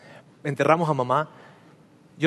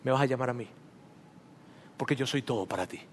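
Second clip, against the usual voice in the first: very soft, lower, tender reassurance; a gentle inner voice, soft and low; voice of God; 6 semitones lower, 14 dB quieter, faster than usual. Retold or imitated. God answering the grieving son, softly tender. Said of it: "la voz de Dios hablando".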